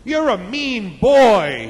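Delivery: loud, high, stretched-out taunt; screamed out. Acted. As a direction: yell it slowly into the distance. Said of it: "He screamed out".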